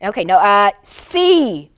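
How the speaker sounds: ordinary talk, nothing marked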